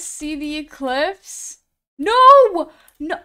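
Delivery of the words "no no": shrill outraged scream, much higher and louder; real outraged screaming at the game; outraged shriek. Real, not acted. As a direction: scream in protest as it's snatched away. Streamer loses item in game.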